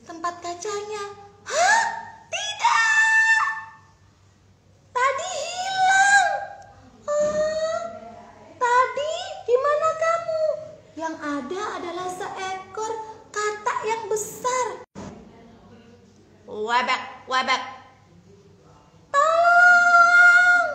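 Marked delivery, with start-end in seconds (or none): 1.4-10.4 high, loud panicked cry; storybook princess shrieking in dismay; shocked crying shout. Acted. Princess finds tadpole gone. 16.5-17.6 croaking frog voice, louder; mock frog croak. 19.1-20.7 very high, very loud scream; scream for help. Acted. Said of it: "Putri kecil teriak".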